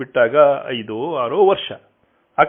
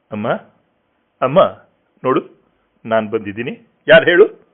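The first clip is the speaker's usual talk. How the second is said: calling out; 5 dB louder, faster than usual. Acted. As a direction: call her, make her hear you. Son calling deaf mother.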